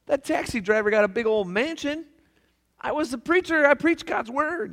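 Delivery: high, loud disbelief; he can't believe the injustice; indignant outrage. Acted. The pastor protesting to Saint Peter, high and outraged. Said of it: "the pastor's like".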